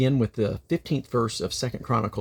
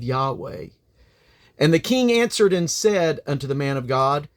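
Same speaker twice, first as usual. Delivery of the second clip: solemn, declaiming Bible reading, raised and louder; scripture reading; 6 semitones higher, 4 dB louder than usual. Acted. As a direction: proclaim the chapter from the pulpit.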